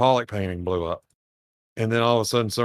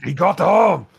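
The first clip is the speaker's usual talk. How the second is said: higher, louder shout; surprised orc; 7 semitones higher, 6 dB louder than usual. Pretend. Orcs see Yarrick with the claw, shocked shout. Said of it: "And they're like".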